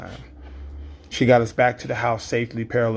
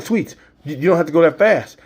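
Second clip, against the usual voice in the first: alarmed plea; 6 semitones higher, 5 dB louder than usual. Retold or imitated. Dad panicking as passenger.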